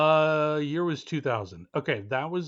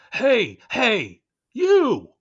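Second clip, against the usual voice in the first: high and loud, calling out; overacted theatrical shout; dramatic shout; 9 semitones higher, 10 dB louder, much slower than usual. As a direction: drama kid yelling across a stage. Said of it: "doing a dramatic reading".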